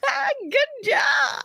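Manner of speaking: high, slow strained praise; forced praise. Retold or imitated. Praising the boy's bad singing, high and slowly strained. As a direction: squeeze out a sweet fake compliment. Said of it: "I'm just".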